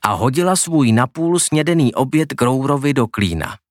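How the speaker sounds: ordinary delivery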